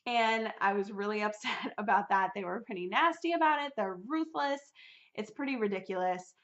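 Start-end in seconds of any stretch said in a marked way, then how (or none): none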